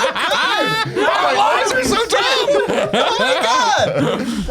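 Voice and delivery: high, slow, laughing squeal of joy; excited shriek. Real, not acted. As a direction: shriek with delight. Thrilled after the duck voice.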